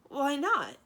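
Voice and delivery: high, loud hurt protest; a wounded why not; sounding hurt. A narrator's reading. Thranduil asks Cimmerian why not, hurt and loud. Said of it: "sounding rather hurt".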